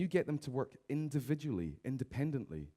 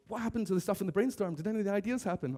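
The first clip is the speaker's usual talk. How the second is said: higher, mock eager questions; eager colleagues; 7 semitones higher, faster than usual. Staff asking the boss weeks later, mock eager and higher. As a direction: imitate keen staff asking about their ideas. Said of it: "All excitedly".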